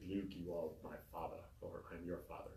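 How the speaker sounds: deep, quiet Darth Vader voice; a lightly voiced deep movie villain; James Earl Jones voice. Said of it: "I sound like James Earl Jones".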